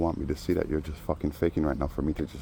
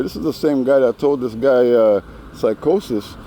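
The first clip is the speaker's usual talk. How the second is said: ordinary delivery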